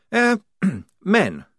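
posh officer voice; lightly voiced upper-class officer; Blouse voice. A narrator's reading.

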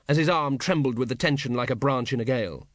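plain reading voice

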